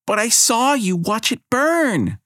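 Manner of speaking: put-on a child pointing the finger; girl accusing. A narrator's reading. Girl accuses the goddess, high and slow.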